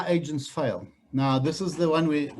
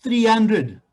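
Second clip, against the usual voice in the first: louder, higher prompt; firm call-out; 5 semitones higher, 7 dB louder than usual. Real, not acted. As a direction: push the group to answer. Trainer demands answer from group.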